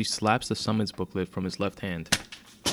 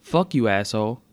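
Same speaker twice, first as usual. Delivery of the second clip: raised-voice insult; shouting back; 2 semitones higher, 6 dB louder than usual. A narrator's reading. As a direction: hurl it back at him, louder. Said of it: "Tracy shouted back at him".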